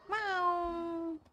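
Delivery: soft high drawn-out mew; high pretend kitty call; cat meow. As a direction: meow like a kitten, long and quiet. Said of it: "I am on my cat form today".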